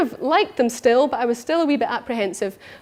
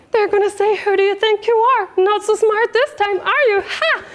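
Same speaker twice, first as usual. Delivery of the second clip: much higher squeaky cartoon mouse voice; Mickey Mouse voice; 8 semitones higher than usual. Woman replaying her inner critic in a squeaky Mickey Mouse voice. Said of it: "use the voice of Mickey Mouse".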